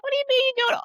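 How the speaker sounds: high incredulous squeak; shocked, voice jumping way up; surprised. Retold or imitated. Surprised reply to members.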